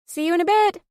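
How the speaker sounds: high, loud call across the room. A narrator's reading. Luna calling out to Alex across the workshop, loud and high. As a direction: call it out to someone far away. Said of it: "Luna called out".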